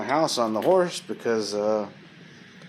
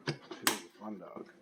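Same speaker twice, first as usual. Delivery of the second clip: much softer and slower, almost to herself; soft repeat; 2 semitones lower, 14 dB quieter than usual. Real, not acted. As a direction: murmur it fondly under your breath. Soft repeat of previous line.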